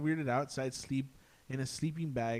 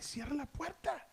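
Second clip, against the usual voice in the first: high, hushed frightened plea; scared plea; 13 semitones higher, 4 dB quieter than usual. Retold or imitated. Terrified relatives urging him to shut the door, hushed and high.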